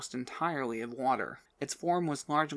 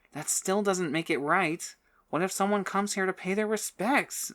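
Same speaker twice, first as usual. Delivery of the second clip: insistent; 6 semitones higher, 4 dB louder than usual. A narrator's reading. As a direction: press the point firmly. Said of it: "Sylvie insisted".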